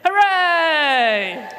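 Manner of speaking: loud, high cheer; a crowd cheering as the enemy runs, loud and high. Acted. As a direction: whoop it like a victorious crowd. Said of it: "they cheered".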